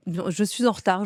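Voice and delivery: ordinary talk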